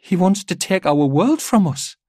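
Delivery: put-on high, loud child voice; a little girl realizing it aloud, high-pitched; child's alarmed voice. A narrator's reading. Daughter speaks to father of the Despoiler, high and alarmed. Child's line in narrated story.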